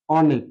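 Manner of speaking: অনেক with the first vowel rounded to ও, said briskly; spoken অনেক. Shown as a demonstration. Teacher showing how অনেক is really said. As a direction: say অনেক as ওনেক. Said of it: "আমরা কখনো অনেক বলি না".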